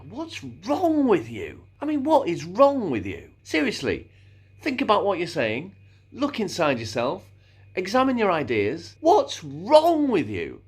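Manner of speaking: high, drawn-out incredulous scolding, "wrong" stretched; dramatic dismissal. Acted. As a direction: lecture them with theatrical exasperation. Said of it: "If I'm writing a script".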